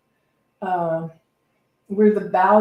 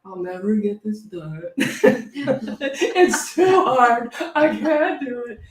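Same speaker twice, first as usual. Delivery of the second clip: mock despair; 2 semitones higher, 4 dB louder, slower than usual. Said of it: "quit claiming".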